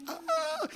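very high, soft helpless squeal; horrified whimper. Retold or imitated. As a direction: let out a tiny high "ah" of dismay. Speechless in horror at her injury.

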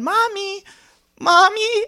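put-on high, small toddler's call; freaking-out toddler. Toddler calling for his mom, high worried voice. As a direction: imitate a little kid calling out high. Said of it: "started freaking out".

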